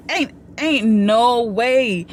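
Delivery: ain't, ain't no way stretched out slowly; slow drawn-out disbelief. Retold or imitated. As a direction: drag each word out in disbelief. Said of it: "I said".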